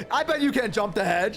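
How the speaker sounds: high, teasing crowd voice; imitated goading cheer. Crowd daring a random passer-by, teasingly goading. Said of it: "cheering random bypassers on".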